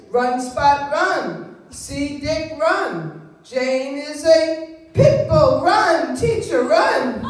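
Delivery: loud, slow sing-song primer read. Shown as a demonstration. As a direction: read it like a first-grade reader. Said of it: "I taught him to read like this".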